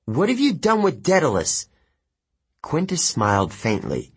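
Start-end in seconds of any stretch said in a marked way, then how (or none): 0.0-1.7 put-on high, indignant girl's demand; urgent, high-voiced accusation; accusing demand. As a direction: confront the traitor, pitch leaping up. Annabeth demands answers from suspected spy.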